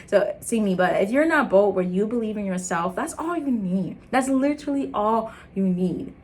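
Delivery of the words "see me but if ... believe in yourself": ordinary talk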